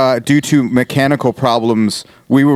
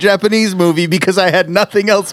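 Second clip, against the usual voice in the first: higher-pitched triumphant boast; proud announcement; 6 semitones higher than usual. Real, not acted. Podcaster announcing he conquered the long movie, triumphant. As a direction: proclaim it to the folks like a feat.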